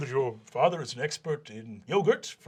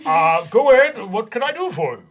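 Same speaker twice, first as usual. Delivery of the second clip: bright, louder official voice; cheery vice principal; 6 semitones higher, 9 dB louder than usual. Acted. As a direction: invite them to speak, chipper.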